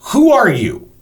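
high, loud, indignant challenge; imitated yell. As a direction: demand it like someone yelling from a stage. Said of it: "She yells from the stage".

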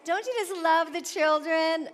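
ordinary talk

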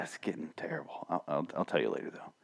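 whispering; hushed aside whisper. Retold or imitated. Quoting his reaction.

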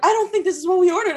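high, fast whiny complaint; imitating customers complaining about dessert; mock customer complaint. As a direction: ham up a fussy diner. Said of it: "they're like".

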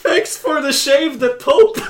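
high, loud, slow squeaky line. Retold or imitated. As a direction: squeak it out like a child. High voiced scroll message.

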